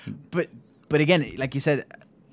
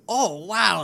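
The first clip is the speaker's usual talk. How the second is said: higher, louder mock amazement; mock surprised reaction; 9 semitones higher, 6 dB louder than usual. Imagined first reaction to the slap, mock surprised. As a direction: exclaim it like an astonished first reaction. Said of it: "your first reaction to be".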